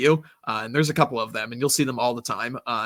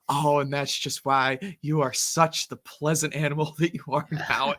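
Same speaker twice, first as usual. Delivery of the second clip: deadpan imitation; 3 semitones higher than usual. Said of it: "just the slow, like, deadpan".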